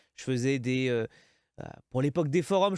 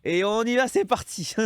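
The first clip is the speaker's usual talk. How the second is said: higher and louder, carried away; mock all-in hype; 7 semitones higher, 5 dB louder than usual. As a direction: throw it all in with a burst of excitement. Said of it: "faire, «Allez, je jette tout".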